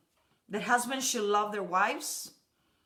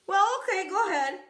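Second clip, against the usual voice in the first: higher, breezy yes from the husband; easygoing husband; 7 semitones higher, 4 dB louder than usual. Retold or imitated. Husband on the phone, easily saying yes. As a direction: give in easily, cheerfully. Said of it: "And he asked".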